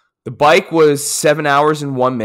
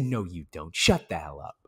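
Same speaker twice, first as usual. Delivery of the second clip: softer mock-dismissive disbelief; 7 semitones lower, 10 dB quieter than usual. Vlogger brushing off a fan, softer mock disbelief. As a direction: play it down in quiet disbelief. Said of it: "I said".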